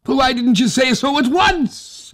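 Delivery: put-on high, loud old man's cry of relief; a delighted old man throwing up his hands; old Tag, relieved. A narrator's reading. Old Tag calls to the hussars, high and relieved.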